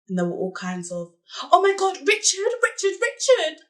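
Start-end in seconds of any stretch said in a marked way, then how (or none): 1.4-3.6 high-pitched mock cries of passion; put-on high, gasped outcry; mimicking the lover. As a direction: mimic her crying out his name, pitched way up. Mimics the lover caught with Richard.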